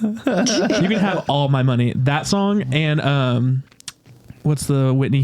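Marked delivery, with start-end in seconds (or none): 0.0-1.9 slow, lightly raised gushing line; mock diva gush. Tipping the queen, slow and gushing. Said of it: "I'll just start".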